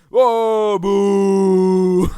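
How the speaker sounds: loud, high drawn-out boo; mock jeering. Audience member booing a talk show guest, hammed-up jeer. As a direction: jeer like a heckling studio audience. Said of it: "They got the jeers".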